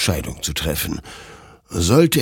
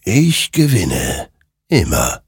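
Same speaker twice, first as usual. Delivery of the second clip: slow, separated, ominous words; ghostly rider, triumphant; slower than usual. A narrator's reading. Rider claims every war before Hector, ominous. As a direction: pronounce each phrase as a final verdict.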